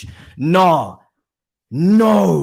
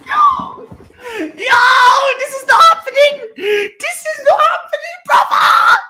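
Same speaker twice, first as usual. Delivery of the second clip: much higher, loud yelling; real euphoric shouting; shouting in disbelief; 7 dB louder than usual. Real, not acted. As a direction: scream it like you can't believe it. Stunned celebration after penalty.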